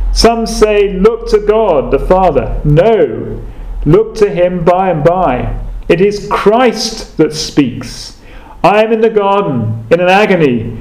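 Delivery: rustic Essex pulpit voice, rising and falling; broad Essex accent. Retold or imitated. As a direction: plead with the congregation in broad Essex. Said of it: "in his broad Essex accent".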